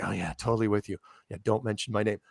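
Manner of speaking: low, softened aside; hush-hush, dropped voice; retold confiding. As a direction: imitate a secret supporter keeping it quiet. Secret supporters voiced in confidence.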